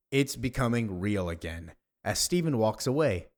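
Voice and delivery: plain reading voice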